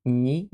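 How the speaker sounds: ni- begun as the ny sound of ñ; Spanish ñ. Shown as a demonstration. Streamer demonstrates ñ to viewers, ny sound begun on ni-. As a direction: start on a palatal ny in ni-. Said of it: "Can you pronounce N?".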